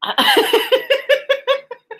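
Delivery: high rapid giggles bursting out; real laughing fit. Real, not acted. Reader trying to resume the reading, cracking up. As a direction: relapse into helpless laughter.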